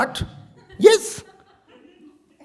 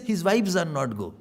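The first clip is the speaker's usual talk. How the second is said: dismissive little verdict; a touch of scorn; mock judgement; 5 semitones lower, 6 dB quieter than usual. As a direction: say it as gossip would. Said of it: "And then we say".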